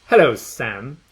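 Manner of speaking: loud sneer; sneering greeting. A narrator's reading. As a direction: greet her with contempt. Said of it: "the angel says with a sneer".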